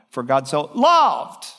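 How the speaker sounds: "loved" drawn out and leaned on, slowly; slow down and weigh the word "loved"; stress on loved. Shown as a demonstration. Preacher demonstrating verse emphasis to the congregation, leaning on "loved". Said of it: "just emphasize ten key places in it".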